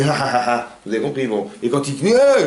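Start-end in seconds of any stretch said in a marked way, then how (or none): 0.0-0.8 demonstrated "ha ha" laughter; imitated happy laugh. Shown as a demonstration. Teacher showing how a happy person sounds, mock laughing. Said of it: "quelqu'un qui est content fait". 2.1-2.4 sudden loud, high "ah" yell; demonstrated angry cry. Shown as a demonstration. Teacher showing how an angry person cries out, mock yell. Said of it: "il crie comme ça".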